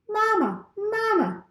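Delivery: high, tiny peeping chick voice; a newborn gosling peeping for its mother. A narrator's reading. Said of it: "peeped the gosling".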